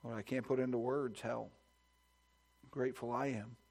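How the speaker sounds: ordinary delivery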